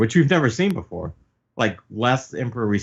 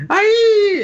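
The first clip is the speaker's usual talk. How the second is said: very high, loud cartoon scream; mock terrified shriek; 9 dB louder than usual. Queen's goons grayed out with fear, loud high shriek. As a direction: shriek it like a frightened henchman.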